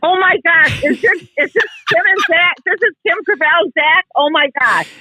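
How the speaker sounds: high, gushing with thrill; excited. Real, not acted. Mom on air with a star she loves, thrilled. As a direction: squeal with real delight. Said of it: "I'm so excited".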